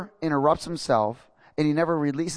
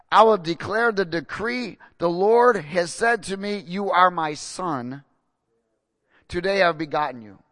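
slow, raised reading; scripture proclamation; 3 semitones higher, 3 dB louder than usual. Acted. Preacher reads God's words over the congregation, slow and raised. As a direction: let "You are my son" land slowly.

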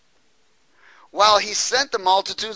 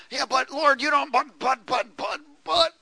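higher stammering protest; whiny excuses; 9 semitones higher than usual. Retold or imitated. A believer objecting to the Lord, high whiny stammer. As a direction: stumble through excuses like a doubter. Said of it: "We all get in that "but".

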